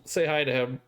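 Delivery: ordinary delivery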